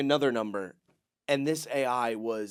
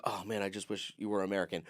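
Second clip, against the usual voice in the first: muttered wish; 7 semitones lower, 12 dB quieter, faster than usual. Retold or imitated. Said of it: "you're like".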